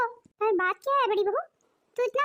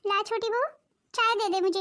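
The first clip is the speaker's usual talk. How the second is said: ordinary talk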